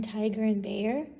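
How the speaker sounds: ordinary talk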